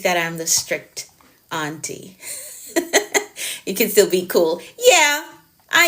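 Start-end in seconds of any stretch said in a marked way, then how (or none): 2.7-3.6 short high giggle; brief airy chuckle; laugh. Real, not acted. Laughing about being strict auntie.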